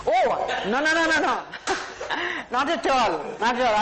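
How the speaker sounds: real laughter through the repeated no; laughing. Real, not acted. Speaker laughs denying to audience, laughing. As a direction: laugh it off while protesting.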